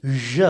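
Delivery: "ज" as a low, soft zh, like the s in vision; isolated zh sound. Shown as a demonstration. Teacher isolating the sound for learners, "ज" as zh. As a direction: buzz "ज" as zh, neither z nor j. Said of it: "एक अलग ही sound आ रहा है".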